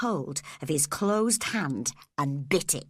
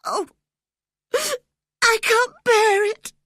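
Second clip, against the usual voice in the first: much higher and louder, near tears; terrified boy sobbing out; 9 dB louder than usual. A narrator's reading. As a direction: break down in a sob. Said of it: "he sobbed".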